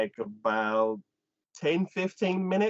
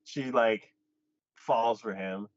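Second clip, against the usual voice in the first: normal talking voice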